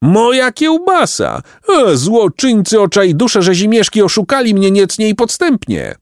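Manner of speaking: bellowed lament. A narrator's reading. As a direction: bellow in outrage over a stolen treasure. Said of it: "rycząc".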